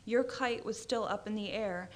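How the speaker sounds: plain reading voice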